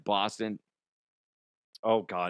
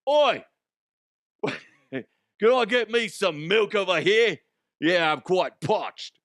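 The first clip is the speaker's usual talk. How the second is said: raised, hammed-up mock Cockney; bad Cockney with a punched "Oi"; Cockney accent; 6 semitones higher, slower than usual. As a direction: put on a cheeky Cockney. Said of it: "It'd be like Cockney".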